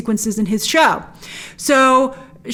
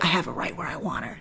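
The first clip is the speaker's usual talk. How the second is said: smug murmur; 5 semitones lower, 13 dB quieter than usual. Retold or imitated. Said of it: "Steve is like".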